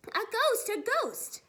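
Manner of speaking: high, loud cry of alarm; panicked yell. A narrator's reading. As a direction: yell it like a frightened crowd. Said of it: "a panic with everybody yelling".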